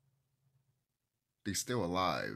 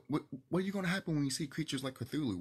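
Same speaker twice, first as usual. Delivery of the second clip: ordinary talk, nothing marked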